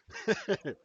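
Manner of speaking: a sudden loud real laugh; big laugh at the clip; loud burst of laughter. Real, not acted. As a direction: crack up out loud.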